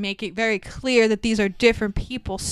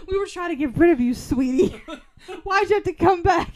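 mock parent; 5 semitones higher than usual. Mock parent reply, laughing.

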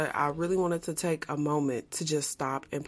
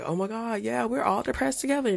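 high-pitched mocking imitation; mock glamorizing; 7 semitones higher than usual. Podcaster mimics people glamorizing depression, mock gushing. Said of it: "how some people try to glamorize depression".

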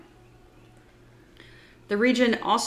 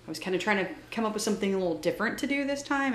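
usual speaking voice

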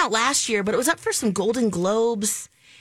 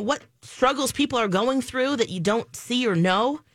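ordinary talk

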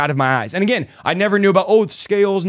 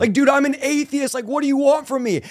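much higher-pitched incredulous protest; retold protest to God; 10 semitones higher than usual. Atheist telling God he has nothing to offer, high-pitched protest.

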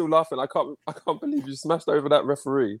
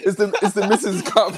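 laughing; 5 semitones higher than usual. Real, not acted. Laughing excuse.

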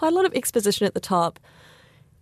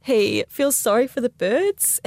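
ordinary talk

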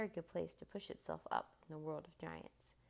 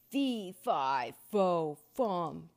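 huge, very loud giant's voice; thunderous storybook giant chant; booming giant; 11 dB louder, much slower than usual. A narrator's reading. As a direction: boom out each word like a giant. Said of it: "boomed the giant".

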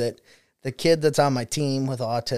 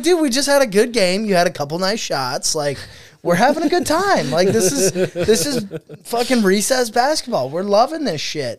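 pleading cheerfully; 7 semitones higher, 4 dB louder than usual. Retold or imitated. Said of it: "I'm like".

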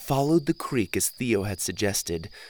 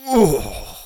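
loud, disgusted "ugh"; gagging at the smell; disgusted groan; 5 semitones higher, 7 dB louder than usual. Acted. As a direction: groan in disgust at a foul stink. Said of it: "made me gag".

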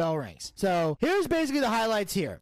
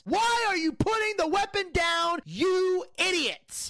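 furious yell; 6 semitones higher than usual. Real, not acted. Host berating a wrestler through the screen, furious shout. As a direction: berate him like he's there.

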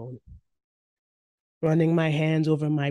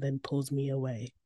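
plain reading voice

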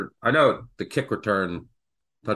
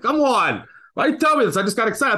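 higher and louder mock disbelief; exasperated protest; 10 semitones higher, 4 dB louder than usual. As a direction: throw up your hands, why would you do this. Retelling his own exasperated reply.

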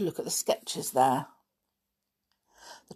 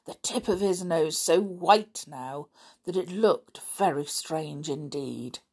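usual speaking voice

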